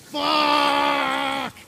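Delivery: high pained yell; hoarse shout. Acted. Hungover man shouting in pain, high.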